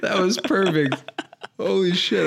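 high, laughing amazement; thrilled it was all recorded; excited disbelief. Real, not acted. To his friend on learning it recorded, high laughing delight.

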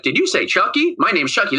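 high mock doll voice; hammed-up high talking doll. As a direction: squeak it like a glitching toy doll. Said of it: "And then he's like".

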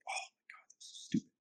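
unvoiced whisper under the breath. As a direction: whisper it to yourself, scornful. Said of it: "And I was like".

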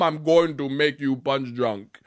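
slow, measured warlord in a put-on African accent; mock a game villain with an African accent. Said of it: "African warlord stereotype going for him".

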